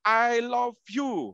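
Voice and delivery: "I love you" said very slowly, each word stretched; misheard as I love you. Retold or imitated. Showing the audience what the schoolboy heard, in slow mock English. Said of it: "Saya dengernya apa?".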